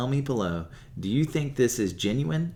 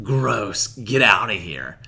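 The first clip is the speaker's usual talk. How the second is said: disgusted brush-off; 8 dB louder, slower than usual. Retold or imitated. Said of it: "they're like".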